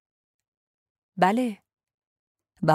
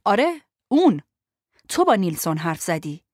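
firm and steady; 4 dB louder than usual. A narrator's reading. Lo confronting Ben about what he told, firmly. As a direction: plant each word, no give. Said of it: "قرص و محکم گفتم".

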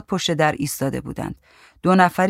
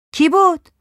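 high, louder questioning voice; high woman's voice; 13 semitones higher, 6 dB louder than usual. A narrator's reading. Woman asking who was at the door, high-pitched.

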